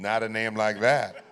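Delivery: loud, slow drawn-out echo; big, loud, savoured agreement; emphatic agreement. Real, not acted. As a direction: boom it back at the audience, stretched out.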